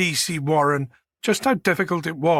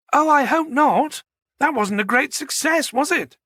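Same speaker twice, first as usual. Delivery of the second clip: high, lilting genteel woman; high-voiced genteel woman; 10 semitones higher than usual. Manager joking about the Titanic remark, high genteel banter. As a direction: banter in a high, old-fashioned ladylike voice.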